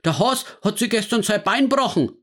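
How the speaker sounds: put-on high, worked-up old man; agitated. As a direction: burst out with the news. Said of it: "sagte er aufgebracht".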